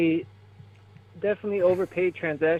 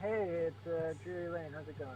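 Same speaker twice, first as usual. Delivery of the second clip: faint, barely audible voice; really quiet; 16 dB quieter than usual. Real, not acted. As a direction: speak very quietly, far from the mic. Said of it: "Hold on, you're really quiet".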